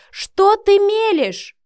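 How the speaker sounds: very loud, high, drawn-out outraged shout; rough shout. A narrator's reading. Son cutting off his mother's claims, shouting. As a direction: yell it, stretching the words. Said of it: "оборвал её грубо Матвей".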